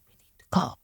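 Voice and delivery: urgent whisper, voicing another's words; hushed, unvoiced urging under the breath; retold whisper. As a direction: whisper it low and secretive. Dad urging her in secret.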